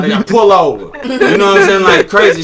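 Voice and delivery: loud, high, laughing retelling; words riding up on a laugh. Real, not acted. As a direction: crack up while telling the story. Laughing at own wild story.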